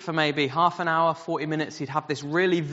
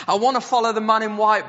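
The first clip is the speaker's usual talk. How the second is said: put-on high, loud, yearning boy voice; eager boy's cry; 8 semitones higher, 6 dB louder, faster than usual. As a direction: call it out like a boy desperate to know. Boy waking from the dream.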